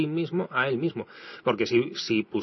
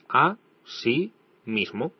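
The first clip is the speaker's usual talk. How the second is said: slow separated words; much slower than usual. Shown as a demonstration. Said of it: "son tres palabras diferentes".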